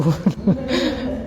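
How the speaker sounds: short laugh after a cheeky word; a light laugh, caught by surprise; quick amused chuckle. Real, not acted. Laughs after saying rude word.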